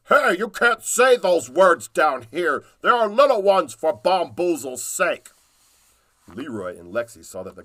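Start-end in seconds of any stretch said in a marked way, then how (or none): 0.1-5.3 high, loud indignant goblin voice; goblin king. Acted. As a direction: scold them like a cranky goblin king. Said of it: "King Giblet said".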